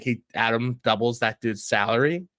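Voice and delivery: usual speaking voice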